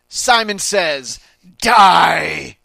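slow command erupting into a huge shout; villainous shout. Pretend. Villain Simon commanding death, full shout. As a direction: draw it out high, then roar the last word.

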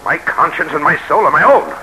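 a loud outburst at the tormenting voice; angry outburst. Acted. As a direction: shut the voice out with force. Refusing the haunting voice.

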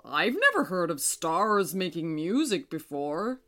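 moon voice. A narrator's reading. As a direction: chuckle like the kindly moon.

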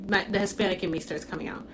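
normal talking voice

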